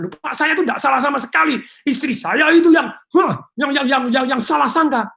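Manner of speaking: loud, high, indignant protest; defensive anger. Retold or imitated. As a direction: snap back blaming the wife. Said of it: "Nada Anda aja sudah salah". (protes marah dengan nada tinggi)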